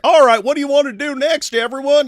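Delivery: high, loud country bumpkin; hammed-up farmer yelling; yokel voice. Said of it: "you see 'em behind the scenes are like".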